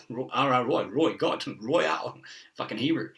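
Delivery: ordinary delivery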